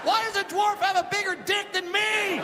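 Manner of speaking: high, drawn-out comic shout; hammed-up indignant howl; outraged disbelief. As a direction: yell in horrified bafflement as the mugger. Mugger discovers photos on phone.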